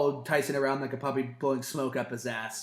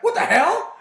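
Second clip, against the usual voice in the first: high, loud mock commentator outburst; hammed-up shocked exclamation; 9 dB louder than usual. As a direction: imitate an outraged announcer's disbelief. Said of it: "J R's like".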